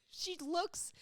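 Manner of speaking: high, squeaky words through real laughter; laughing while talking. Real, not acted. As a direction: try to get it out while cracking up. Cracking up describing mom's photo.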